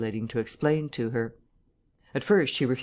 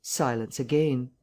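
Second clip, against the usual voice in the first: plain reading voice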